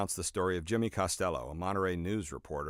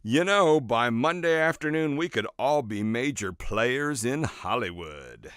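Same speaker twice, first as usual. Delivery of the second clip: louder, higher, grinning excitement that slows at the end; smiling boast; 4 semitones higher, 5 dB louder, slower than usual. Retold or imitated. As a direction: beam with hopeful overconfidence. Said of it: "With a big smile".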